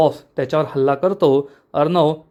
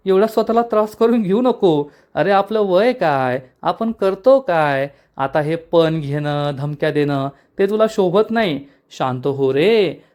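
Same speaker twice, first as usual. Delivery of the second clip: mocking villain; 2 semitones higher than usual. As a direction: talk down to the old man with fake concern. Villain mocks captive brother.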